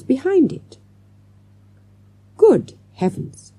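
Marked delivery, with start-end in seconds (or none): 2.4-3.5 slow, drawn-out exclamation of shock; astonished cry. A narrator's reading. Teacher unwrapping the parcel, astonished. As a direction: stretch it out in astonishment. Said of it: "cried Mister Jones".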